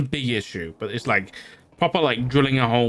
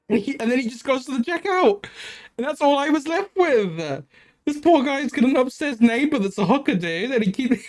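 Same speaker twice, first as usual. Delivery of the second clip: high-pitched, barely containing laughter; laughing; 10 semitones higher than usual. Real, not acted. Wrapping up the story of the hooker upstairs, cracking up. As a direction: tell it through giggles, pitch way up.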